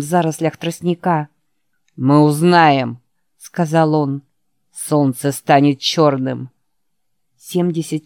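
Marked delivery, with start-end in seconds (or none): 2.0-3.0 snarling villain. A narrator's reading. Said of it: "оскалился, как кабан". 4.7-6.5 snarling villain. A narrator's reading. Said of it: "оскалился, как кабан".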